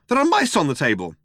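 high, alarmed exclamation; startled yelp. A narrator's reading. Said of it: "and suddenly yelped".

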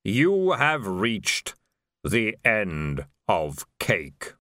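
very slow, solemn, deep intoning; Death. A narrator's reading. Death to the dead lord, slow and solemn. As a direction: draw out each word with finality.

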